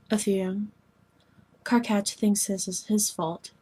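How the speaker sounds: usual speaking voice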